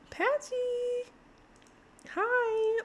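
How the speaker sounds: girl gamer voicing her Sim greeting him cutely; high cutesy Diana voice. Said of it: "She's like".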